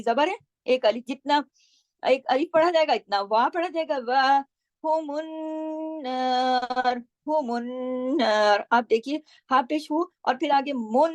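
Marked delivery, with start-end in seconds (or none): 4.0-8.7 व हुमुन नार, वा held one alif; slow recitation. Shown as a demonstration. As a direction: draw out वा, ग़ुन्ना on नून. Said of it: "नार क्यों किया". (खड़ी जबर और ग़ुन्ने के साथ धीमी तिलावत)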